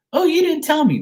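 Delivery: very high, put-on voice of a put-out friend; mock complaint. Reader imitates a friend left out of the news, high mock complaint. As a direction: squeal it as a mock-offended follower.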